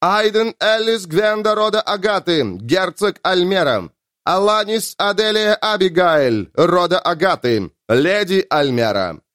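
ceremonial herald's declaiming; town crier. Acted. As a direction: declaim each name to the hall. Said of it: "Герольд продекламировал".

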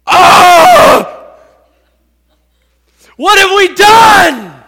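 very loud, high anguished wail; guilt-stricken cry. Acted. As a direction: cry out in horror at your own guilt. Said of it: "Can you imagine the guilt".

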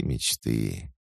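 wistful sigh. Shown as a demonstration. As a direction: let it fade with longing. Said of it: "если бы можно было".

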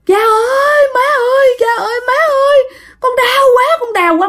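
acted child screaming in pain; child's crying shriek. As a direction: cry out for your parents, shrill and stretched. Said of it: "nó ré lên nó khóc".

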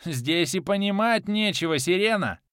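high, loud mocking madman; mock her with glee; laughing madman. A narrator's reading. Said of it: "продолжил смеяться Кармадонт".

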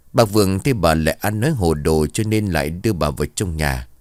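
plain reading voice

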